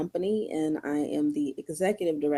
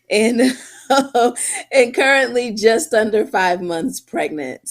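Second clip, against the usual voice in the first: normal talking voice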